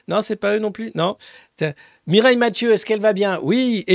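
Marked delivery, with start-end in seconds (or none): none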